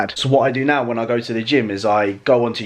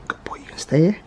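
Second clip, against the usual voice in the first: whispered to dog; 4 semitones higher than usual. Real, not acted. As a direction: hush it gently to the pup. Soothing dog before leaving.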